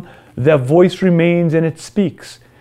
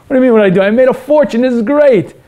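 much higher and louder, fed up; defiant outburst; 7 semitones higher, 3 dB louder, much faster than usual. Acted. Selfish man loudly tells his conscience to leave him alone. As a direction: shout it off, defensive and annoyed. Self boasting.

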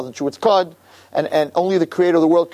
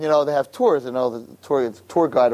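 usual speaking voice

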